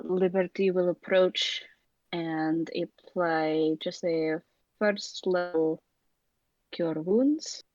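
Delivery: ordinary talk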